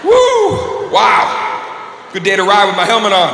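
high hammed-up whoops; whooping. Guy in a bar whooping to show off his helmet. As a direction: whoop like a biker showing off.

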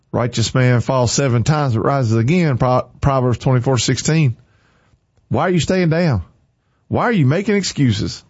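ordinary talk, nothing marked

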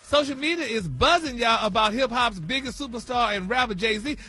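celebrity news read. Acted. Host opening the celebrity news for listeners, fast and loud. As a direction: rattle it off like breaking news.

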